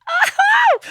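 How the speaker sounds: very high, loud delighted exclamation; proud cry. Retold or imitated. Recalling finishing her slides, a very high proud squeal. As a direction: squeal with pride. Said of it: "that proper proud feeling".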